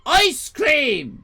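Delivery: high, loud sign shout. Retold or imitated. The ice cream sign calling out loudly. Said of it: "they said".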